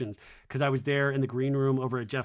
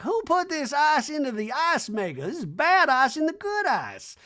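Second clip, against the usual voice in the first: [name] voice; 12 semitones higher, 5 dB louder than usual. Retold or imitated. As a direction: drone it like a grand old dame. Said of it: "[name]'s like".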